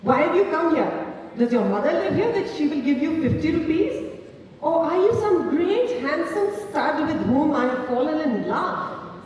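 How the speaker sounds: woman throwing out her pimp with biting scorn. A narrator's reading. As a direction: spit each insult at him. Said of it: "responded in a biting tone".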